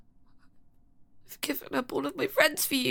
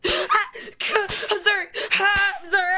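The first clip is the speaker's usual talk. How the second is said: slow, louder, tearful sorry; crying apology; 4 semitones higher, 6 dB louder than usual. Acted. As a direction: sob out the apology.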